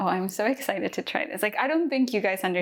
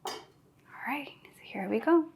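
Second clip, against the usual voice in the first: hushed whisper; 6 semitones higher, 4 dB quieter than usual. Real, not acted. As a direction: breathe it out softly. Quiet start before the tasting.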